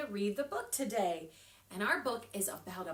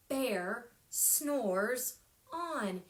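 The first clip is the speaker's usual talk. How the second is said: slow title; much slower than usual. Acted. Reading title to children.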